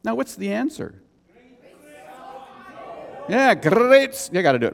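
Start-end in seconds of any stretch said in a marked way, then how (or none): 3.5-4.3 "great" loud and high with a long rolled R. Shown as a demonstration. Preacher shows off his catchphrase, "great" with rolled R. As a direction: trill the R hard in "great". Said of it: "Roll the tongue".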